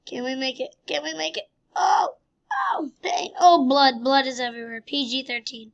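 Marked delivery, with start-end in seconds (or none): 1.8-3.3 sudden yelp, pitched up; hammed-up ouch; mock pain cry. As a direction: cry out as if hurt. Ragdoll crashes, cries in pain.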